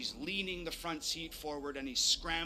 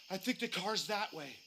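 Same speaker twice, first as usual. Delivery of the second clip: exhausted gasp; 4 semitones higher than usual. Retold or imitated. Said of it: "Olivier gasping ... exhausted".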